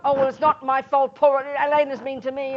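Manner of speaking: mock the blame-shifting whine; self-pitying excuse. Teacher mocking the excuse she should not make, high and loud. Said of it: "Not, 'Oh, well".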